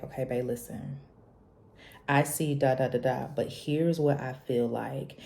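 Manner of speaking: put-on soft, gentle boyfriend voice; hushed, tender partner; calm and loving. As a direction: reassure her gently. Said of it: "so calm and so loving".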